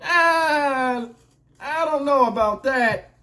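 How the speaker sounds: mock dodge. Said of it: "The bill come".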